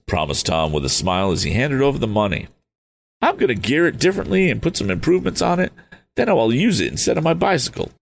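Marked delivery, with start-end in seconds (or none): 3.2-7.9 put-on higher, eager young voice; Tom, fired up and youthful. Tom rallying his father, higher and eager. Tom excited about motorcycle.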